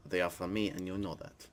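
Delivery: muttering. Acted. God muttering aside.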